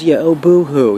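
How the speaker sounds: mock him as a crybaby; doctor scoffing at a whiny patient, mock sobbing; mocking boo-hoo. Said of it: "And he goes".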